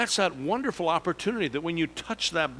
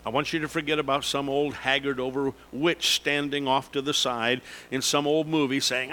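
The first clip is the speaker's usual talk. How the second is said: ordinary delivery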